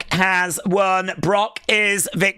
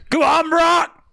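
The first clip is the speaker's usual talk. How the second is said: cheering on; 9 semitones higher, 3 dB louder than usual. Acted. Egging Brock on, builds up.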